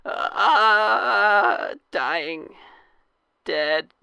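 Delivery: high strained last gasp; wounded soldier gasping. Acted. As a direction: fade out on a dying breath. Dying soldier's last breath.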